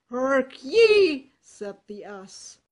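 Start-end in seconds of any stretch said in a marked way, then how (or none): 0.1-1.3 loud, bold donkey voice; a confident ass calling out to a friend; hearty donkey. Acted. Ass character speaks.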